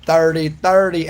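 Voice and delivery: "Thirty thirty" drawn out and punched; slow "thirty thirty". Shown as a demonstration. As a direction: spell out "thirty" slowly. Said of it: "you can say dirty".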